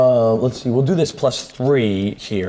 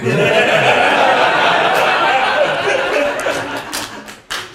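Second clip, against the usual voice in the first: breathy laugh. Real, not acted. After mock incantation.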